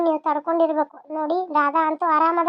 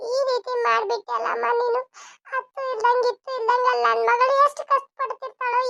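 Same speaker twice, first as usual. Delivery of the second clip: high, crying lament over her lost daughter; tearful wailing; 6 semitones higher than usual. Acted. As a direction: wail it out through tears, higher than her usual voice. Mother weeps over eloped daughter. (ಅಳುತ್ತಾ ಗೋಳಾಡುವುದು)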